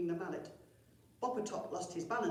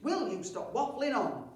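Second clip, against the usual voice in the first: higher, louder irritated chief; irritated snap; 6 semitones higher, 7 dB louder than usual. Acted. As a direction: tell him off sharply. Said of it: "snapped Chief Cheesy Feet".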